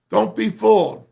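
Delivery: loud, sharp warning with the voice jumping up; emphatic warning. Real, not acted. Coach warning his players, loud and emphatic. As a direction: warn the players hard.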